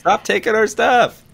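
put-on high, slow little kid voice; Bingo voice. Retelling Bluey episode, Bingo speaking.

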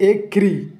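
एक्री high and set apart; isolated word part. Shown as a demonstration. Teacher showing where the syllable ends, एक्री said high and apart. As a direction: raise एक्री, then stop cleanly. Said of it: "syllable खत्म".

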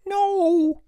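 very high, long wail of protest; anguished shriek. A narrator's reading. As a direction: shriek it in horror. Elmer protests ants being freed.

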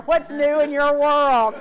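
slow, loud, bright topic change with a laugh; dramatic shift. Shown as a demonstration. Coworker dramatically changing the subject, slow and laughing. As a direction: yank the chat somewhere happier. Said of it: "This is a very dramatic one".

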